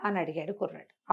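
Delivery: plain reading voice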